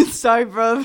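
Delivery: loud, jokey British apology; attempted British accent. Trading accents with a friend, loud mock British. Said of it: "I can't do accents".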